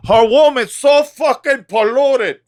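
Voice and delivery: high and loud, put-on voice; mock character voice. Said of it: "That's how I get into character".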